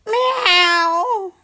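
cat meow. Acted. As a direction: meow to beg for attention. Cat girl meowing for attention.

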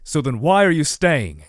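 loud, angry demand; cross demand. A narrator's reading. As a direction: snap the question at her. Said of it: "he demanded crossly".

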